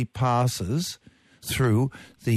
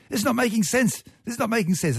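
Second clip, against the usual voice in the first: high-pitched imitation of a protesting child; squeaky-high retold kid's protest; young daughter; 11 semitones higher than usual. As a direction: mimic an incredulous little girl, much higher. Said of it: "And she stayed up and she said".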